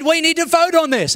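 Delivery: retold indignant outburst; heated demand. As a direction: demand it across an argument. Commander challenges in retold quarrel.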